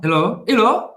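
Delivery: "Elo, elo" with the final A closed towards O; A fechado. Shown as a demonstration. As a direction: echo "elo" with a rounded, closed last vowel. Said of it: "É mais fechado". ("elo" com a vogal final fechada)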